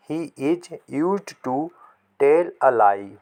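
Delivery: very slow, each word spaced out, the correction made clear; teacher fixing a misprint for children, slowly; slow corrected sentence. Shown as a demonstration. Give the corrected version slowly. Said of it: "ये गलत छप गया है".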